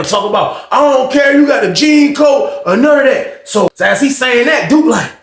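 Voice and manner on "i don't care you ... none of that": higher mock shout; retold yell. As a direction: imitate the hater yelling. Quoting the hater.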